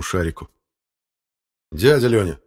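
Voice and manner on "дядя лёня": reproachful exclamation, raised pitch and volume. Acted. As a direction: call him out with reproach. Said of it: "укоризненно воскликнул Фёдор Игнатьевич". (укоризненный возглас)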